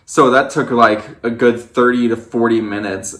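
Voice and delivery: ordinary talk, nothing marked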